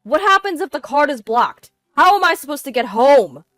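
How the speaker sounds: loud, high, panicky questions; stranded husband frantic for help; shaken pleading. A narrator's reading. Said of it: "His voice shaking, he pleaded with me".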